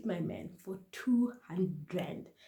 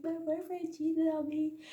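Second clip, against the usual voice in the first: pitched way up, a mock-tearful whine; teary confession; 9 semitones higher than usual. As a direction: whimper it to dad like a hurt kid. Confessing to dad at night.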